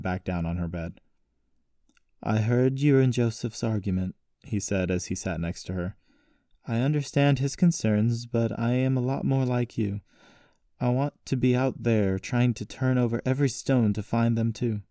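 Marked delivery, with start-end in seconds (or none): 2.2-4.2 lightly voiced uncle, pitched a little higher; a hint of a caring uncle; sympathetic uncle. 6.7-14.8 lightly voiced uncle, pitched a little higher; a hint of a caring uncle; sympathetic uncle.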